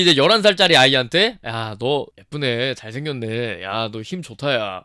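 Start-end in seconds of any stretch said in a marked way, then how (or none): 1.5-4.8 low, soft, slow creepy compliments; slow, low predatory praise to an eleven-year-old; creepy flattery. Retold or imitated. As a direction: linger over it like a creep. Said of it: "정신 나간 짓을 한 거야".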